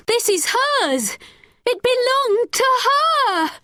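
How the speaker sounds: put-on girl insisting with the necklace; insistent. A narrator's reading. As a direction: shove the proof in his face. Becky holds up necklace.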